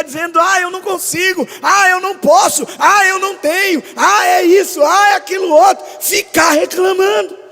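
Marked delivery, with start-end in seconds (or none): 0.4-5.8 high, loud mocking whine; mock a self-pitying believer; whiny complaining. Pastor mimics believer complaining to God, high mocking whine. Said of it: "de ficar choramingando".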